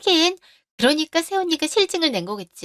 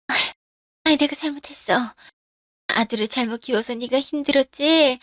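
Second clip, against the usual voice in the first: ordinary delivery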